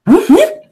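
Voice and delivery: high police siren whoop; imitated siren. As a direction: whoop like a squad car siren.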